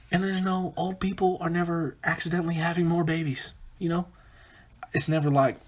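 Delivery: usual speaking voice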